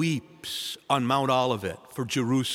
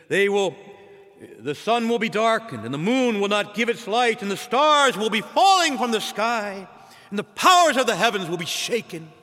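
proclaiming; 6 semitones higher, 3 dB louder than usual. Retold or imitated. Preacher proclaims the Lord's words.

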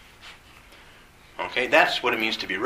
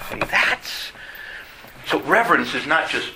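ordinary talk, nothing marked

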